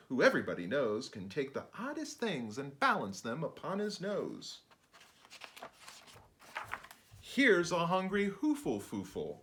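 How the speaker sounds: put-on lively sing-song read-aloud for kids; bouncy picture-book narration; rhyming storybook reading. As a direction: read it like a bedtime rhyme to children.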